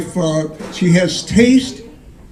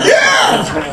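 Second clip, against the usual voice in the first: excited whoop; 3 dB louder than usual. Real, not acted. Whoop with laugh.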